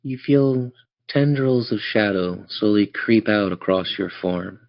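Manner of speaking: usual speaking voice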